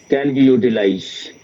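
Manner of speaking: usual speaking voice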